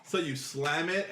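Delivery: ordinary talk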